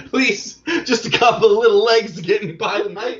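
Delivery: high, pleading addict voice; begging junkie. Acted. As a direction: beg for bug legs.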